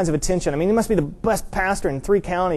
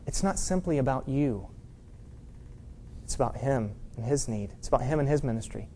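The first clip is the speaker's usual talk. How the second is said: much softer and lower; quiet, earnest correction; 5 semitones lower, 12 dB quieter than usual. Retold or imitated. Janitor's wife correcting the pastor, quiet and low. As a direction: gently set him straight in a hushed, low voice.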